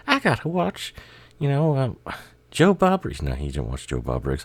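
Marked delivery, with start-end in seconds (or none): 0.1-3.2 much higher, lighter mock Michael voice; [name] impression. Michael telling his brothers he must watch TV, in a mock high voice. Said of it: "No, he didn't talk like that".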